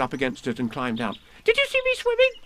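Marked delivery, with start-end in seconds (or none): 1.4-2.3 put-on very high, loud baby squeak; little kangaroo showing off swimming; squeaky Roo. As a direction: squeak it with glee. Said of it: "squeaked Roo excitedly".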